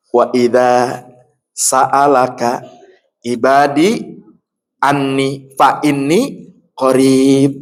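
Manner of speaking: slow, drawn-out verse recitation; Quran recitation. Acted. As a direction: chant the verse slowly and reverently. (tilawah ayat perlahan)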